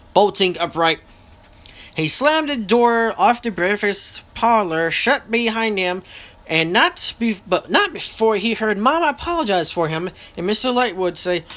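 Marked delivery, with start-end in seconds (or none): none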